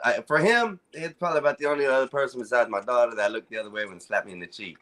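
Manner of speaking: normal talking voice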